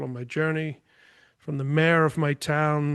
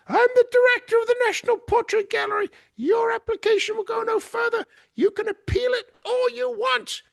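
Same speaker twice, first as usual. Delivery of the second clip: very high, shrieking imitation of an angry director; shrill raised voice; 4 dB louder than usual. Gallery director ending an argument with the painter, mimicked shrill shouting. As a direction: mimic her screeching at the top of the range. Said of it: "She raises her voice and she says".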